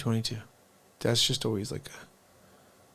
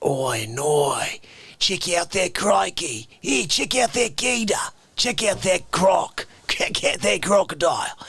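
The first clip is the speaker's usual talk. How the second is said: hammed-up Australian accent, higher and slow; 6 semitones higher, 5 dB louder, slower than usual. Streamer showing a viewer his Australian accent, hammed-up Australian. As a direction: stretch out an Australian wildlife-host drawl. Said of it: "if I could do an Australian accent".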